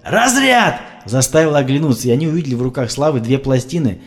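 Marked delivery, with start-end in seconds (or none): 0.0-0.8 high shouted shock call; loud command. A narrator's reading. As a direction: bark the shock warning. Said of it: "громкое".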